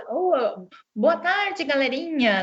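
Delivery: imitating a child copying YouTubers; sing-song greeting, lifted on "boa tarde"; YouTuber intonation. Therapist mimics kids greeting like video hosts, YouTuber intonation. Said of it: "essa questão da entonação a gente vê muito".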